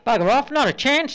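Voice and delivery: mildly annoyed husband; grumpy husband. Acted. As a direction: brush the stranger off. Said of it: "says the husband".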